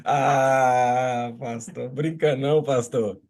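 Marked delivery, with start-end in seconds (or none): none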